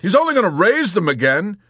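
loud, high-pitched retort; exasperated. A narrator's reading. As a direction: shout it back in exasperation. Yossarian argues with Dobbs.

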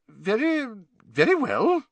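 high, startled flustered nurse; a flustered high yelp of agreement. A narrator's reading. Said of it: "looking startled".

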